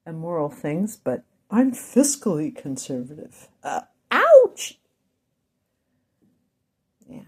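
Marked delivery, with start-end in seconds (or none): 3.6-4.8 sharp high yelp of pain; sudden ouch. Real, not acted. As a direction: jolt out a loud startled ouch. Sudden pain mid-sentence.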